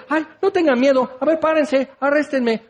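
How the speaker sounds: high, fussy, apologetic whine; hammed-up overly sorry Jesus; mock pleading Jesus. Mock soft-hearted Jesus, wrong version.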